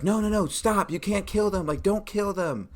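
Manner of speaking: high, urgent townsfolk plea to stop; pleading townsperson. Retold or imitated. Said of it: "they're like".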